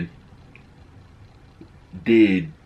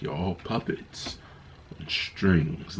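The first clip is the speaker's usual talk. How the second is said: ultron menace; 2 semitones lower, 10 dB quieter, slower than usual. Retold or imitated. Quoting Ultron's menacing line.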